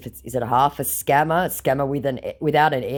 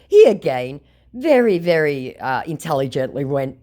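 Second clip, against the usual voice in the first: ordinary talk, nothing marked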